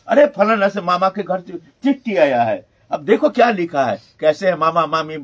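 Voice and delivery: loud, lively retelling of villagers' joy; happy excitement. Retold or imitated. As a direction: act out excited news of a letter. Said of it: "बड़े खुशी मनाते थे".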